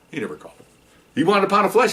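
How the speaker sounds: ordinary delivery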